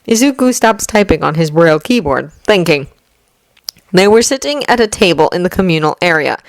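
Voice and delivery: usual speaking voice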